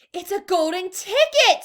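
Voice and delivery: high, very loud shopkeeper scream. A narrator's reading. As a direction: shriek it out in total shock. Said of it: "screamed the shopkeeper".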